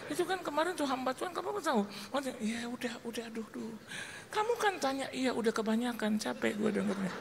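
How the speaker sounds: very high, soft mimicry; imitated couple's exchange. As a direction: mimic weary domestic bickering in a high, hushed voice. Percakapan suami istri ditirukan.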